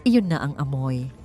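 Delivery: plain reading voice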